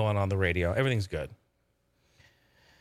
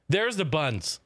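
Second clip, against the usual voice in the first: normal talking voice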